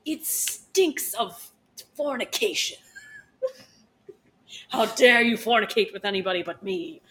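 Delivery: mock-tragic jealous indignation; melodramatic outrage. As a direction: play the scorned lover, hammed up. Hammed-up jealous outburst in scene.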